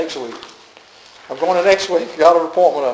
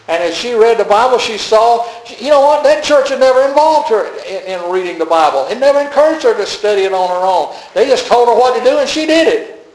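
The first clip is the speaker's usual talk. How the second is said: impassioned, raised preacher's pitch; emphatic preaching; 6 semitones higher, 4 dB louder than usual. Real, not acted. Preacher faulting her old church to the congregation, emphatic preaching. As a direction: preach it with rising indignation.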